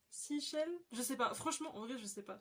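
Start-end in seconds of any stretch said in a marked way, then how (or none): 0.1-0.7 English with a French accent, questioning; unsure, higher French-accented attempt at English; bad English accent. Real, not acted. Unsure how to pronounce it for viewers, French-accented English. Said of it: "J'ai un accent anglais merdique".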